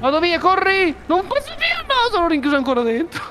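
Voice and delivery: high, panicked shouting; frantic, voice cracking high; being chased. Real, not acted. Fleeing, trapped again.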